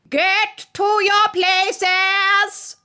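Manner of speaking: high, loud, hugely stretched shout; voice of thunder. A narrator's reading. Queen orders players to places, thundering. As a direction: bellow every word long and loud. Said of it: "in a voice of thunder".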